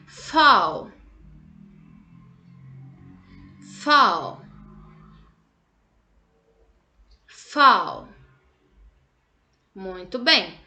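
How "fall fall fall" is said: put-on teacher modelling Fall for kids to repeat; model word. Shown as a demonstration. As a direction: Fall said loud and slow, falling. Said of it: "Então, repete aí".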